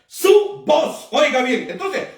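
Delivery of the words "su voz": su voz raised and firm at the close; stress on su voz. Shown as a demonstration. Preacher insisting on one voice, raised emphasis. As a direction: land su voz higher, a final hammer. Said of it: "no voces".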